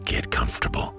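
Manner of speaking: ordinary delivery, nothing marked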